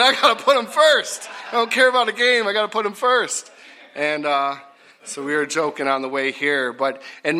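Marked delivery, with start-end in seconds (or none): 0.0-3.5 high, loud, insistent comeback; mock preacher shout. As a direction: playfully holler your refusal to budge. Acted joking retort to his boys.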